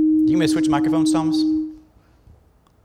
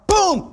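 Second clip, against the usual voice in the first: boom; 13 semitones higher than usual. Retold or imitated. Buggies collide in aisle.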